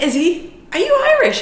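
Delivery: high, loud surprised question. Retold or imitated. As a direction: squeak it out in disbelief. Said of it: "I was like".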